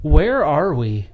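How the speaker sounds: dazed hostage. Acted. Mock hostage skit opening.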